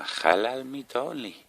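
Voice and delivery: slow, badly faked Arabic accent; a clumsy put-on Arabic accent, slow. Sign at a shop read out in a bad Arabic accent.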